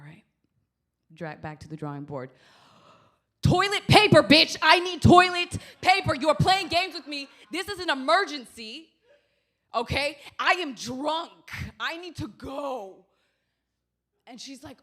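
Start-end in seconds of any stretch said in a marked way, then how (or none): 3.4-13.0 put-on loud, high, desperate drunk rant; shouting drunk demanding toilet paper; drunk yelling. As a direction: yell it like a drunk in an emergency.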